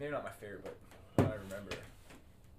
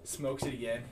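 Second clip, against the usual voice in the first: usual speaking voice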